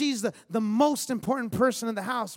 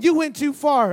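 loud hurt protest; kid's protest; 3 semitones higher, 9 dB louder than usual. Retold or imitated. Boy scolding dad in his head, loud protest. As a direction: call it out loudly, a child wronged. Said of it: "I remember as a kid thinking".

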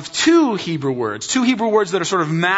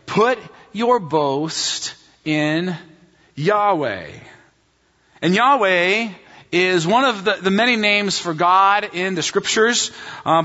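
normal talking voice

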